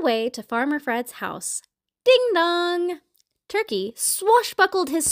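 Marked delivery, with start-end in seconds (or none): 2.0-3.0 sing-song two-note doorbell; doorbell chime. A narrator's reading. Doorbell rings at the farmhouse, sing-song chime. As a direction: chime it high, holding the last note.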